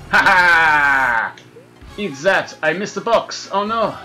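normal talking voice

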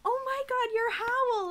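normal talking voice

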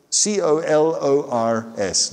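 slow, letter-by-letter spelling; one letter at a time; spelled out. Shown as a demonstration. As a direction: spell it out slowly. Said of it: "no U".

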